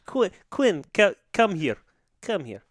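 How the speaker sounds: mock Russian coach, coaxing; bad Russian accent. Coach telling Quinn he is valued, mock Russian accent. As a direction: call a player over in a fake Russian accent. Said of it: "the worst Russian accent".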